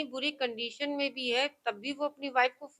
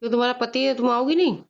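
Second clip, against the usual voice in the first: loud, real reproach; heated, loud questioning; confronting; 9 dB louder, faster than usual. Real, not acted. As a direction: challenge her with a raised voice. Confronts wife in a real quarrel.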